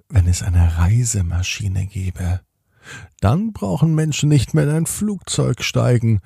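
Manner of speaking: plain reading voice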